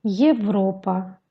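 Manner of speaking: "Европа" slowly, opening on a y-glide plus è; ye at the start. Shown as a demonstration. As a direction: say "Европа" slowly with a clear initial "ye". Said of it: "le mot Европа".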